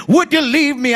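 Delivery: high, loud preacher's shout; impassioned cry. Retold or imitated. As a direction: cry the question out at full force. Climax of sermon, God's question cried out.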